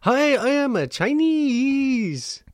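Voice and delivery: high, slow mock Chinese accent; drawn-out, hammed-up Chinese accent. Shown as a demonstration. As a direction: demonstrate an exaggerated Chinese accent. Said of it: "You know the Chinese accent?".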